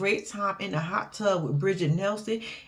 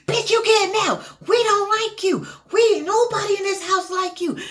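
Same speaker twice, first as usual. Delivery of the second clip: high, louder mock yell; mock shouting; 10 semitones higher, 4 dB louder than usual. Housemate yelling at New York in the van, high, louder mock yell. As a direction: shout her down in the quarrel.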